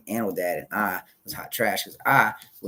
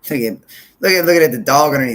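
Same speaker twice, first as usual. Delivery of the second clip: excited; 7 semitones higher, 13 dB louder than usual. Real, not acted. Excitedly points out the dog.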